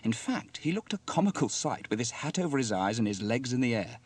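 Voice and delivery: plain reading voice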